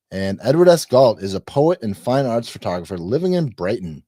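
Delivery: ordinary talk, nothing marked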